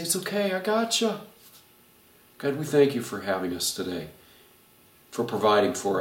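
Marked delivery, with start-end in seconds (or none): 0.0-1.2 reassuring. Pretend. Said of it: "You say".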